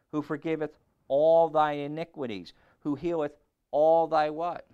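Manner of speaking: preaching call. Acted. Preacher quizzing the congregation, loud and high. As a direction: call the verses out to the crowd. Recites verses, prompting crowd.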